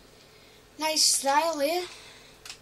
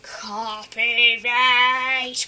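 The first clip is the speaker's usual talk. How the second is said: copyright stretched out very slowly; very drawn out; 5 dB louder, much slower than usual. Acted. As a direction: draw copyright out for comic effect. Drawn-out joke word.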